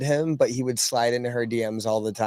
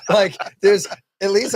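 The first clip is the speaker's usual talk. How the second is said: real laughter breaking into the words; amused, words bubbling with laughter; laughing while talking; 7 semitones higher, 4 dB louder than usual. Real, not acted. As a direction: talk through a laugh. Laughing about friend's bad tattoo.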